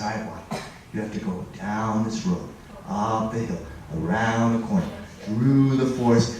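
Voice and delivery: lightly voiced old man, hushed and secretive. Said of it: "He said".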